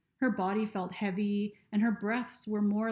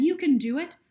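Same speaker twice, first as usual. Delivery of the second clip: louder encouraging call upward; 7 semitones higher, 7 dB louder than usual. Acted. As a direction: call up to her, cheering her on. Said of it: "Lucy called up".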